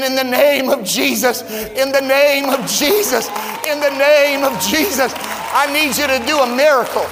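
fervent pleading prayer, climbing; building prayer plea. Retold or imitated. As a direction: press the plea harder every time. Retold prayer, repeated building.

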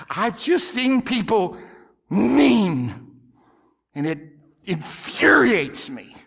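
real fury. Real, not acted. Preacher telling the congregation what infuriates him, slow and angry. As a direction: let the anger choke the words. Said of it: "I was furious".

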